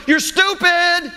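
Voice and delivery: high, slow, drawn-out bratty yell; mock child yell. Preacher imitating a child yelling across the aisle, high and drawn out. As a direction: yell it over the shelves like a kid in a tantrum. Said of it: "yelling over, over the shelving".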